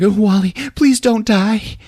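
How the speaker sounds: mock plea. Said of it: "she's like".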